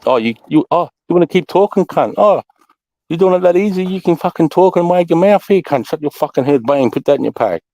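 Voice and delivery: high-pitched mock telling-off; acted sergeant's scolding. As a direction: play the sergeant laying into a mouthy soldier. Acting out punishing his soldiers.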